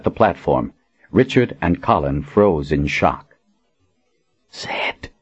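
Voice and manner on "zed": breathless shocked whisper. A narrator's reading. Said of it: "Richard whispered".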